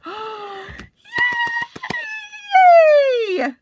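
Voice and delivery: breathy gasp into a happy yay; delighted gasp and yay. Real, not acted. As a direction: squeal of joy at the rings. Unboxing, delighted.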